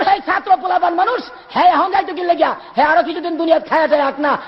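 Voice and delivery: high, carrying storyteller's plea; pleading explanation. Acted. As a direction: start high and ease down as you explain. Old teacher's acted explanation to king. (উঁচু গলায় আবেগী কৈফিয়ত)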